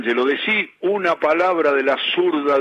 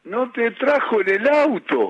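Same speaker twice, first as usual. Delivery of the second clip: higher-pitched, amused disbelief; surprised echo question; 7 semitones higher than usual. Real, not acted. Interviewer reacting to the friend's revenge, surprised.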